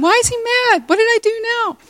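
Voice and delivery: high, fretful inner voice; mock panicked worry. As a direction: act out an anxious inner wail. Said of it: "You're thinking".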